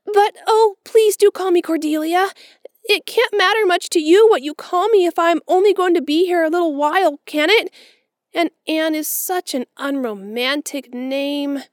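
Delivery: put-on high, loud, gushing girl's voice; a romantic child longing for a grand name; dreamy orphan girl. A narrator's reading. Orphan girl to Marilla, gushing high and loud. Anne's pleading.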